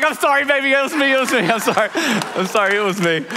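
high-pitched, pleading mock confession; retold hurried apology. Dad confessing prank to tearful daughter, high apologetic blurt.

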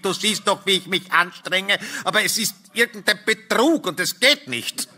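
high-pitched exasperated protest; strained call. A narrator's reading. Husband excusing his failure to his wife, strained high protest. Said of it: "rief ich".

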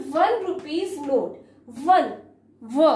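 "वन" dengan bunyi व yang ditekan jelas; stressed w sound. Shown as a demonstration. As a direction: pisahkan "व" dan ucapkan keras. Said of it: "व की आवाज आ रही है".

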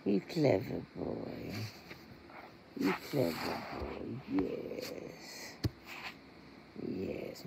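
half-whispered, slow praise for the cat; soft pet cooing. Real, not acted. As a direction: murmur fondly to the purring cat.

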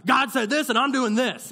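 mock the know-it-all believer, loud and sure.

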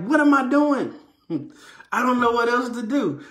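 high, loud exasperated cry. Retold or imitated. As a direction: throw up your hands, high and loud. Exasperated teacher in trouble.

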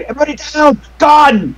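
high, urgent acted yell; yelled warning. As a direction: shout a warning to the whole room. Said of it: "and so you yell".

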